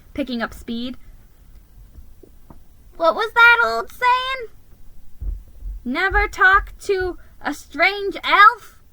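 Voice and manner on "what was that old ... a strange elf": self-scolding. Acted. Mouse scolding himself.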